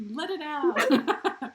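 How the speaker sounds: slow, loud, high sing-song call; very slow, loud cheering call; drawn-out shout. Real, not acted.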